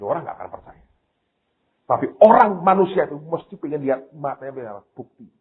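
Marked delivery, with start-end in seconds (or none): none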